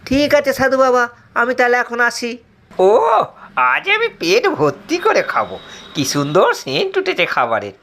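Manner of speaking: put-on high, eager young disciple voice; a naive disciple chirping to the sage; simple disciple. Disciple answering the sage in a high, eager voice.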